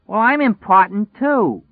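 high, loud strident demand. Acted. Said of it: "She was starting to be strident".

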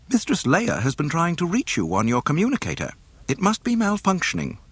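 high, prim droid voice; fussy robot butler reporting; C-3PO. A narrator's reading.

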